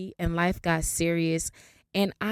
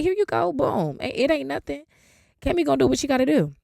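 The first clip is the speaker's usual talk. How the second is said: breezy confidence; 7 semitones higher, 3 dB louder, faster than usual. Retold or imitated. Breezy retold handover of license.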